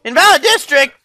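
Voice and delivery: high, loud startled question; a loud, high outburst of surprise; surprised. Real, not acted. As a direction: caught off guard by the rejection. Game rejects the document.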